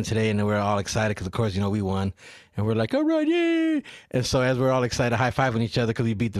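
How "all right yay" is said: mock cheer. Retold cheering after win.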